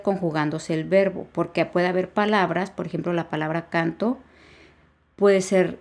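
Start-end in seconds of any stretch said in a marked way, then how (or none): none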